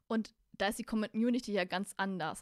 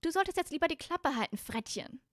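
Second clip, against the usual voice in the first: high-pitched scolding character voice; high female character; 7 semitones higher, 4 dB louder than usual. Acted. High girl's voice tells Malfoy to shut up, scolding. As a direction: tell him off in a raised girlish voice. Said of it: "sorry, das war Ron".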